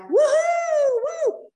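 high, loud cheer; excited whooping. Retold or imitated. Room mom spots the bus at last, excited whooping. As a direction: whoop wildly at full voice.

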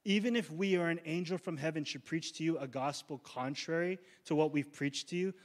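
ordinary talk, nothing marked

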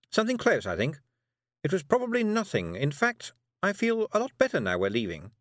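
a busy, slightly nervous witch; Miss Tick. A narrator's reading. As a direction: witch talking a girl into a walk. Miss Tick's voice.

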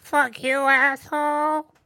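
high, loud put-on old-lady rant; imitated furious old lady. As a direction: rant like an old woman flipping off a driver. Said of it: "It's like".

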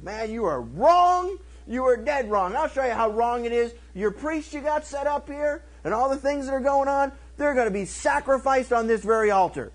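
high, emphatic rebuke; prophet's denunciation. Retold or imitated. Prophet confronting a wicked king, emphatic denunciation.

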